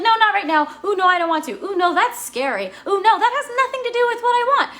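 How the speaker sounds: high, loud, squeamish protest; panicky refusal. Acted. Client refusing her intuition's whisper, high-pitched protest.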